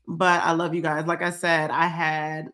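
usual speaking voice

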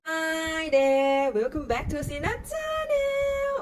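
high, far-up hammed-up hello to viewers; bright channel intro. Host greeting her viewers in a high put-on voice. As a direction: sing out the welcome like a show host.

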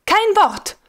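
high, cutting order; sharp command. A narrator's reading. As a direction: cut him off. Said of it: "rief der schöne Major".

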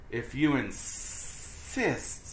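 very slow, drawn-out snake; lazy after-lunch snake, slow. Acted. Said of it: "said the snake".

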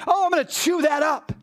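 high, mock-happy sarcastic voice; sarcastic delight. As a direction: pretend to relish the criticism. Mock delight at being criticized.

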